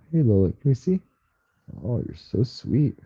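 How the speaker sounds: soft coaxing to the cat. Real, not acted.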